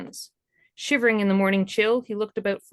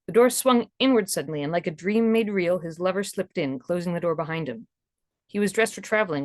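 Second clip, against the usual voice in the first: plain reading voice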